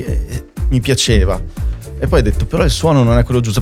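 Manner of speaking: normal talking voice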